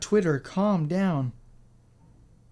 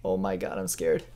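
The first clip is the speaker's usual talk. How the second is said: much lower, soft mock-frightened voice; mock scared; 11 semitones lower, 9 dB quieter than usual. Gamer joking fear at the creepy announcer voice, mock scared. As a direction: play it as fake fright.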